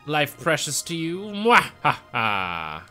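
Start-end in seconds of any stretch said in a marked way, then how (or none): 1.2-2.8 slow, drawn-out evil laugh; evil villain laugh. Acted. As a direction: cackle like a gloating villain.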